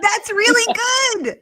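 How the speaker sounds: high, excited cheer with laughter around it; real high-pitched excitement; delighted praise. Real, not acted. Delighted praise of accent attempt.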